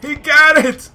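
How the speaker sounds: high, loud shot call easing off in disbelief; astonished hype. Real, not acted. Player watches the mascot hit a three, astonished hype. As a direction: yell it as the three drops.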